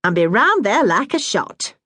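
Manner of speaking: a hint of a brisk northern mum; Bessie, motherly Yorkshire woman. Bessie's voice in dialogue.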